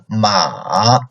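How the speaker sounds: "ma" low in the third tone. Shown as a demonstration. Teacher showing students the lowest tone, low dipping "ma". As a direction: drop "ma" low and let it dip. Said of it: "third tone lowest".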